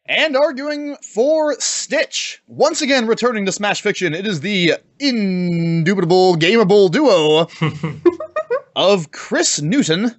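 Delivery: drawn-out game show announcer; slow, grand introduction of the contestants. Acted.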